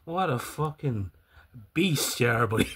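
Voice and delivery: usual speaking voice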